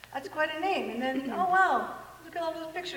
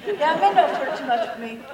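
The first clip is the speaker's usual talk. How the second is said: ordinary talk, nothing marked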